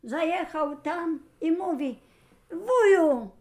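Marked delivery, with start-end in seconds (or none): 2.5-3.3 loud, higher, drawn-out call to an uncle. Acted. Hero hails his uncle to confront him, calling out. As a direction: call out to him, raised in pitch and volume.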